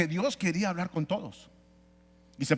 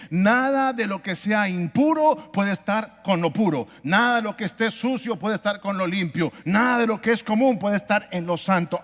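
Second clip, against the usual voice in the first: loud, raised sermon voice with heavy emphasis; forceful preaching; 3 semitones higher, 7 dB louder than usual. Real, not acted. Preacher declares no communion with God, forceful preaching. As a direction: proclaim it to the whole congregation.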